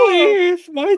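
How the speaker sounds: very high-pitched, loud mock wailing; mock victim wail. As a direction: squeal it like a hammed-up crybaby. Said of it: "they was like".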